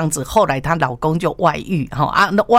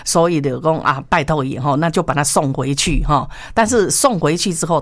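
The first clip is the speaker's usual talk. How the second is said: ordinary delivery, nothing marked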